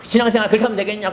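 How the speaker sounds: high, fast rebuke; stern mother rebuking; fast scolding. Retold or imitated. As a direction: scold him rapidly. Mother rebukes his faith.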